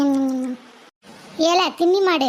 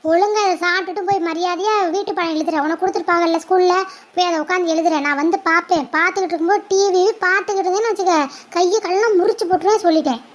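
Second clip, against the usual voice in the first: ordinary talk, nothing marked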